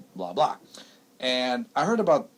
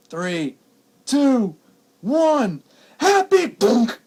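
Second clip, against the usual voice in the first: rising, louder countdown ending in a high yell; mock countdown shout; 11 semitones higher, 9 dB louder, much slower than usual. Son retelling the Y2K prank, high countdown shout. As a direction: count down and explode on the last word.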